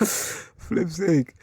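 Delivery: high laughing exasperation. Real, not acted. Laughing at his own terrible pun. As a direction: laugh it off at a bad joke. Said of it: "That was horrible".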